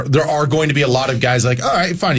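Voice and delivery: ordinary talk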